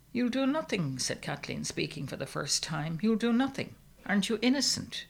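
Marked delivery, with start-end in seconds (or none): 0.1-1.0 high-pitched, dismissive wife's answer; high opening, falling away; firm reply. A narrator's reading. Wife's first curt reply. 3.0-5.1 raised pitch, insistent wife; high and rising at the end; insistent reassurance. A narrator's reading. Wife insists to distressed husband.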